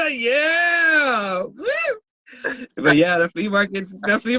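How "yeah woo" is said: cheering. Real, not acted. Cheering after a laugh.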